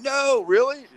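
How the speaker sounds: imitating an astonished stranger; mock surprise. Quoting stranger amazed.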